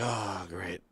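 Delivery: low, soft, resigned aside; dismayed reaction. Retold or imitated. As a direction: let it sink out quietly, resigned. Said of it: "I was like".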